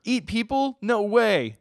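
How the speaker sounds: high, loud shocked denial; high startled protest; Denji, shocked. Acted. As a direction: squeak out the disbelief. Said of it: "but I'll be Denji".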